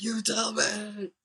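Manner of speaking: high, stretched-out imitation scream; mock scream. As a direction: throw back an imitated villain's scream, slow and high. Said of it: "He's screaming".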